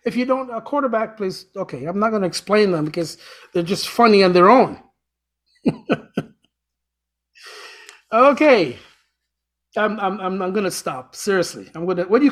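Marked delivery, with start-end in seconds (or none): 5.6-6.2 brief, very high-pitched laugh; short giggle. Real, not acted. Host laughing at their own dad jokes, short high giggle. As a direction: giggle at your own joke.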